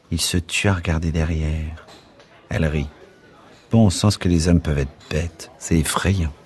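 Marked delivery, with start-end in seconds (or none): none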